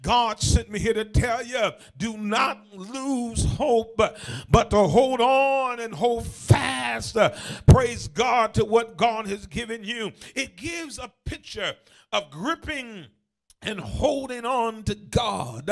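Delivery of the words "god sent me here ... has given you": loud, urgent pulpit delivery; full-force preaching. Real, not acted. Preacher telling the church not to lose hope, full force. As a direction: preach it hard, punching the key words.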